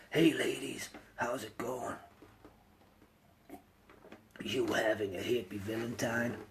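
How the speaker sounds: Batman voice. Pretend. Sore-throated streamer greets ladies as a superhero, low gruff whisper. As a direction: growl it like a caped crusader. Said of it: "playing the part of Batman".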